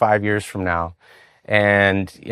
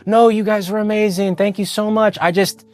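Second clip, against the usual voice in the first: high, effusive mock praise; imitating a grateful prospect; gushing customer impression; 10 semitones higher than usual. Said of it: "empathetic person".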